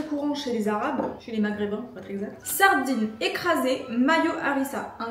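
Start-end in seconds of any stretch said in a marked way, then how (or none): 2.4-5.0 slow announcement. Pretend. Announcing the dish.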